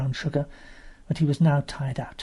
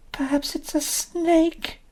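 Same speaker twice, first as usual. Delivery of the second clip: high, slow nervous guess; a hint of a frightened little girl; wary child; 13 semitones higher, slower than usual. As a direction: wonder fearfully, drawing it out.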